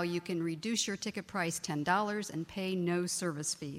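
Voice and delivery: ordinary talk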